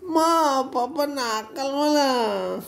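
imitating whiny child. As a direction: tease the kid by copying his whine. Father mimics crying kid.

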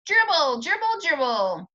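put-on high, loud chanting of little kids; high chanted shout of small children. A narrator's reading. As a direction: shout it like a gang of toddlers. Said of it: "they all started to shout".

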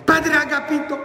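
high-pitched panicky call; anguished housekeeper. Acted. Housekeeper bursts into the dining room, acted anguish. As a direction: call out high, in hammed-up distress. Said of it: "toda angustiada".

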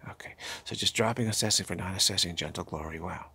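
whispering the mantra back in; hushed meditation refrain; whispered mantra. Acted. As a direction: breathe it as a whisper, gently.

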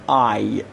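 آی as one gliding "eye" sound. Shown as a demonstration. Teacher demonstrating the German diphthong, آی as "eye". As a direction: say آی like English "eye".

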